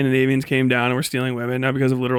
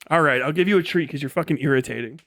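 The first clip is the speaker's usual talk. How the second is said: normal talking voice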